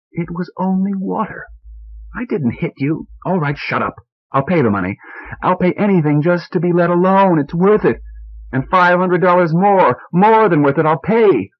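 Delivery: high, bitter angry outburst; exasperated shouting. A narrator's reading. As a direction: snap back in exasperation.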